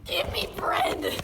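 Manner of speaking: slow shouted protest cry; angry mob shout. Acted. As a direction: yell it like a starving rioter. Angry locals in French riot.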